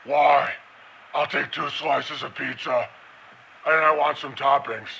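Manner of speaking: a mild mock Batman growl; lightly voiced brooding Batman. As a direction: order pizza like a half-hearted Batman. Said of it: "He talks like this".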